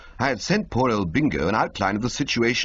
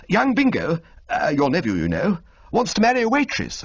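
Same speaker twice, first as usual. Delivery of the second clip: higher and brighter, blurting the news; a nervous gent rushing it out; excited young toff; 10 semitones higher, 4 dB louder than usual. Acted. Bertie breaking news to Old Little, high eager toff voice.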